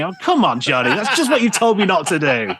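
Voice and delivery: laughing. Real, not acted. Laughing at boss hypocrisy.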